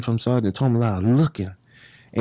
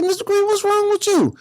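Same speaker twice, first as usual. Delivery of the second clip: very high, alarmed cry of protest; high startled mock outcry; shocked protest. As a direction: cry out in disbelief. Shocked at being attacked.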